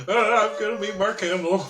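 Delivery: high, giddy fan squeal through laughter; mock starstruck excitement. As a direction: play an overexcited fan. Mimicking a nervous fan meeting idol.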